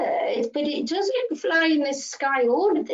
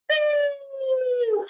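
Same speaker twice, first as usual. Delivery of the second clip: playful boo; 8 semitones higher than usual. Acted. Peekaboo reveal.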